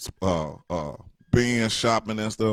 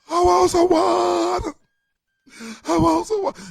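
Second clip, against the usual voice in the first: hammed-up scream; 5 dB louder than usual. Neglected parent begs from the back room, mock screaming. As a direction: scream it like a helpless parent with a dry mouth. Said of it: "screaming cracker dust".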